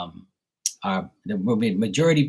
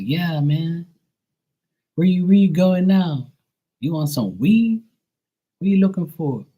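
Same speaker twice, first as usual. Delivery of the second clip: pitched-up Georgia street talk; imitated Georgia accent; 5 semitones higher, 3 dB louder than usual. Georgia local offering a visitor weed, imitated Georgia accent. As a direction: put on a higher Georgia local's voice. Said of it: "everybody there would be like".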